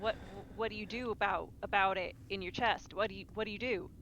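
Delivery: ordinary talk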